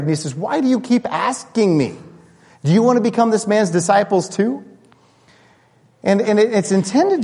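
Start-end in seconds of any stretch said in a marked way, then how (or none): 0.4-4.6 exasperated blind man pushing back; mild mock frustration; frustrated. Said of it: "he finally gets frustrated".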